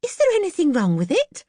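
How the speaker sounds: a frightened wife asking carefully; nervously. Acted. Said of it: "said Missus Banks nervously".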